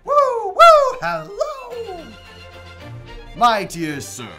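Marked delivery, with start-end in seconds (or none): 0.0-2.1 very high, loud whooping call; giddy whoop. Acted. Scrooge whoops hello to the passing gentleman. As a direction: whoop like a delighted child.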